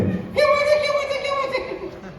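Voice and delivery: very high, slow put-on voice; squeaky mock listener. Comedian voices a heckler asking, high and slow. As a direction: pipe it up slow like a cartoon kid.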